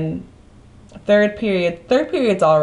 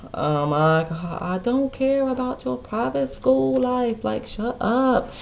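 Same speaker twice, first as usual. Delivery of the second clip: high, slow, exasperated and fed up; exasperated dismissal; 6 semitones higher, 4 dB quieter, slower than usual. Retold or imitated. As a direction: brush him off with a drawn-out eye-roll. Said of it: "And I was like".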